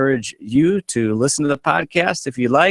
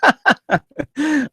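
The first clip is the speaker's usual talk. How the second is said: squealing burst of laughter; high laugh. Real, not acted. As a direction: let out a high cackle. Laughing at Big Voice Guy.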